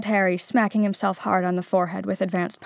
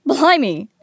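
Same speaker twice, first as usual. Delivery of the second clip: exclaiming; 6 semitones higher, 8 dB louder than usual. A narrator's reading. Ron amused exclamation.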